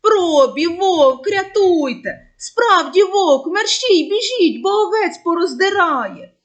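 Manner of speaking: loud, high panicked calling; desperate cry for help. Acted. Boy calling the shepherds to a real wolf, loud panicked yelling. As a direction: yell for help as the sheep are attacked. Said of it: "пастух не вгавав".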